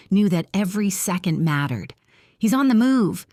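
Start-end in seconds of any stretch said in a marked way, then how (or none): 2.4-3.3 higher, louder urgent command; urgent order. Acted. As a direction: snap out the order. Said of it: "he ordered".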